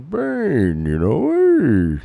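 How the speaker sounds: loud, high-pitched hammy villain voice; big, swooping put-on menace, high and loud; Bane. Shown as a demonstration. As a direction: threaten bedtime like a comic-book villain. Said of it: "And I was doing".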